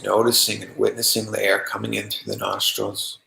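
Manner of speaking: calm, even meditation voice with long pauses; unhurried, settled meditation guiding; guided meditation. Acted. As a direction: guide them gently into relaxation, leaving long silences.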